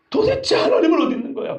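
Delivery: high, pained retold cry; anguished outcry. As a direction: cry out like an onlooker demanding God. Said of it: "이렇게 외쳤어요".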